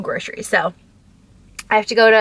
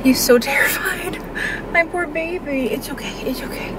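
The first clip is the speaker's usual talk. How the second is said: tender cooing; 6 semitones higher than usual. Real, not acted. Owner comforting her terrified dog, tender cooing. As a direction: coo in sympathy, then soothe gently.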